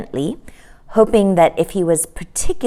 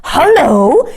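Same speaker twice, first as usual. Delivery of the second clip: a bright excited call, louder; 9 semitones higher, 7 dB louder than usual. Acted. Michael at the window spots something, excited. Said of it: "said Michael excitedly".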